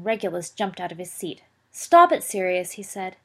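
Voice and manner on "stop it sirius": urgent protest. Acted. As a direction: jump in and shout him down. Younger brother protests at the table.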